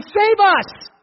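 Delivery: high, loud cry of "Save us!"; scream. Acted. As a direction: scream it for the coming king. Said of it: "We're gonna scream".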